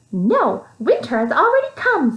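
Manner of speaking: mouse voice, high, smothering a grin; teasing mouse. Acted. Mouse teasing Tweez while hiding a smile. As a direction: tease with a hidden smile. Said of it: "tried to hide a smile".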